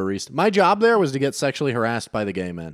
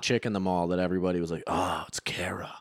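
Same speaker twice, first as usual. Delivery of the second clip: whisper; 7 semitones lower, 8 dB quieter than usual. Retold or imitated. Gossip about Kara at mall.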